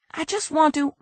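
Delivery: high, alarmed crook voice; a scared crook backing off; panicked crook. Acted. Crook begs the detective not to bring the husband, high and panicked. Brady voice.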